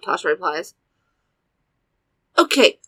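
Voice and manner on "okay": urgent, higher and louder asking; frantic question. A narrator's reading. Asking how to help a friend, frantic. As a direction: ask it frantically, voice lifted and louder. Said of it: "I ask frantically".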